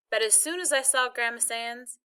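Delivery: ordinary delivery, nothing marked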